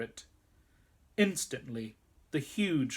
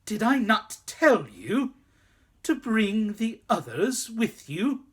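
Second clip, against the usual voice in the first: much higher, louder scolding; furious queen; 7 semitones higher, 6 dB louder than usual. A narrator's reading. Witch demanding why the others are missing, furious. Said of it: "in a terrible voice".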